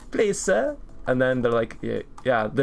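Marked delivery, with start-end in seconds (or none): 0.1-0.8 put-on high little-boy British voice; British orphan boy. To the lunch server, a high British orphan plea. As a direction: plead like a tiny orphan. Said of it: "I go up to them and I'm like".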